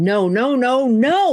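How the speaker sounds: high, loud panicked scream. Acted. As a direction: scream it out in terror. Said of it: "I'm screaming".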